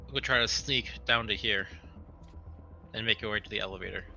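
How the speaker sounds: ordinary delivery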